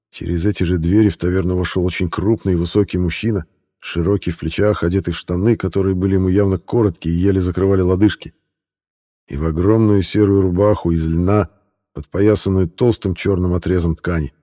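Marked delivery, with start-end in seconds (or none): none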